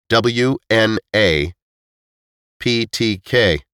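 slow spelling out. Acted. Reading initials off cornerstone.

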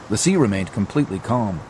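ordinary delivery

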